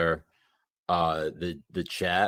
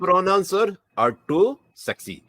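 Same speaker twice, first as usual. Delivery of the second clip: put-on high, loud Pakistani accent voice; 10 semitones higher, 5 dB louder, faster than usual. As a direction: ham up a Zoomer Pakistani accent. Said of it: "talk like a Zoomer in a Pakistani accent".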